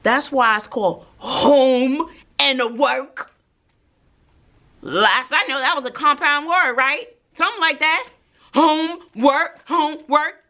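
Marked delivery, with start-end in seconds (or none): 1.2-3.3 HOME and WORK, each drawn out slowly and separately; pointed word-splitting. Retold or imitated. Teacher explains homework to students, slow and pointed. 8.5-10.4 "Homework" split slowly into home and work, each rising; pointed sounding-out. Retold or imitated. Teacher spells out homework to students, slow and pointed. Sarcastic breakdown of compound word.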